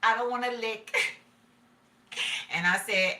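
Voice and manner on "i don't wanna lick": imitating mama. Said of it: "and mama said".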